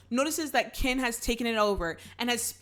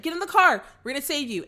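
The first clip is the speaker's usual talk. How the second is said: higher, louder call from the car; 5 semitones higher, 5 dB louder, faster than usual. Retold or imitated. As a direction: shout a rescue from the car window. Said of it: "they're just like".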